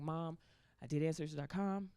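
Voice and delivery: low, soft phone confession; confiding to mom. Retold or imitated. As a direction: break it to mom quietly. Hushed phone call to mom.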